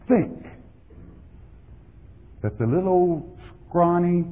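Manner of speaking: ordinary talk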